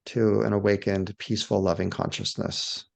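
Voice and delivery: low, spacious meditation voice with long pauses; guided meditation. Shown as a demonstration. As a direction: lead them gently outward, one phrase at a time. Leads a guided awe meditation.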